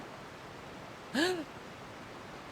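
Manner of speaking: sharp, high delighted gasp; excited gasp. Real, not acted. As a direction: gasp with sudden joy. Sees a new dog.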